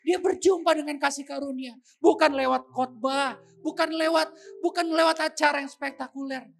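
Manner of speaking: very loud, sustained proclamation; forceful preaching. Real, not acted. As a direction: declare it at full force to the congregation. (berkhotbah lantang penuh tenaga)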